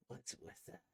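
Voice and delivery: whisper. Acted. Quiet reply.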